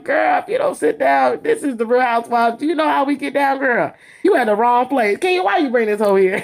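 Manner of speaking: the housewives' hammed-up shouting put-down; mock shout-down. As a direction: shout her back into her seat. Housewives telling Jamie Lynn off.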